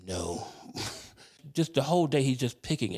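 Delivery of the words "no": low, quiet mock deadpan no; retold low, muted refusal. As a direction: shut him down softly and low. Deadpan reply to perky nurse.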